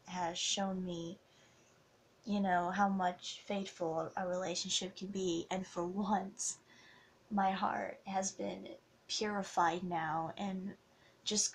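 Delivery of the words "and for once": usual speaking voice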